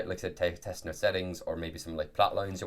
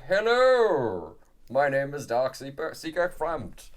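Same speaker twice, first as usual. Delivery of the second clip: high, loud giant serpent voice; Frampt impression; 8 semitones higher, 5 dB louder, slower than usual. Game master greeting players as a giant serpent, high loud creature voice. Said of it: "that's how they sound in the thing".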